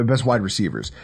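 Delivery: usual speaking voice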